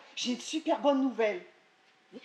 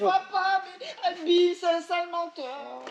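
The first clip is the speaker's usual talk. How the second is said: tearful complaint; 5 semitones higher, 3 dB louder, slower than usual. Acted. Crying to father.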